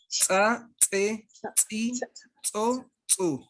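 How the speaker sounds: "tsa, tse, tsi, tso, tsu" with a stronger ts that catches in the throat; glottal ts drill. Shown as a demonstration. Teacher demonstrates the glottal ts to students, forceful ts. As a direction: spit the ts out a bit harder, glottalized. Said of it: "la T Z glotal ... más fuertecita".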